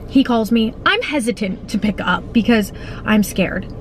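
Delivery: usual speaking voice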